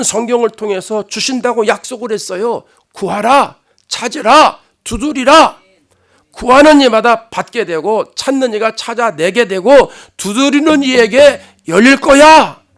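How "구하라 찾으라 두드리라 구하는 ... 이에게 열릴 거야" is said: loud, rising sermon cry; full-force preaching. Acted. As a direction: proclaim it to the whole church.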